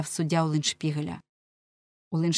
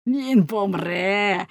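loud, drawn-out pronouncement; gloating cry; 5 dB louder, much slower than usual. A narrator's reading. Gleeful fishmonger calling for death, crying out. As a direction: call it out loud and slow. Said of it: "кричав Рибник".

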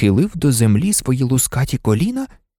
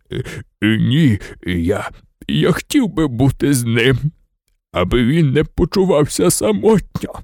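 Hagrid. A narrator's reading. Hagrid stammers, wanting to stay.

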